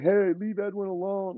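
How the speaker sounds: high-pitched imitation of people objecting; retold bystander protest. Housemates telling the intruder to back off, imitated protest.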